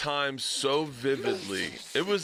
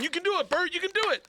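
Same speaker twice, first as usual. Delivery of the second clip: much higher, excited mock cheering; imitated cheering crowd. Audience urging a nervous comic on, high-pitched retold cheering. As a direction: egg him on like a cheering crowd. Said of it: "everyone started cheering".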